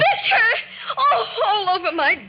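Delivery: high, loud shocked cry; startled outcry with a half laugh. Acted. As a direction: shriek as the milk hits her dress. Milk thrown over her dress.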